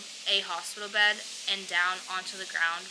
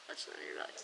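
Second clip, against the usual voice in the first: Ultrasound on your belly whispered, much lower and breathless; 12 dB quieter, much faster than usual. Retold or imitated. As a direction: breathe the words as a tired aside to yourself. Whispered echo of the previous phrase.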